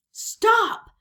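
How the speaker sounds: high, loud yell; shouted command. A narrator's reading. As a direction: yell after men running away. Captain calling after fleeing men.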